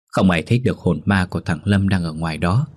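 ordinary delivery

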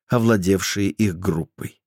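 ordinary delivery

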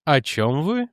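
careless, offhand question; casual. A narrator's reading. Jannek feigning ignorance to Edwards, casual. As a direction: ask it lightly, with a rising end. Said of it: "небрежно спросил Дженнек".

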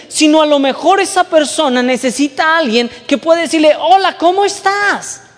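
high, loud urgent preaching; fervent sermon appeal; preaching at full force. Acted. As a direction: drive the point home to the congregation. Preacher pressing his point.

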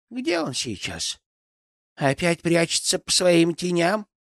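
a grumbling forest granny; old crone voice. Acted. Yaga voiced in story.

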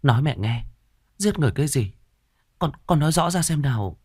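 mother holding her crying daughter, soothing. Acted. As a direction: comfort her and coax the truth out. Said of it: "vỗ về an ủi".

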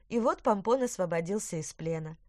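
plain reading voice

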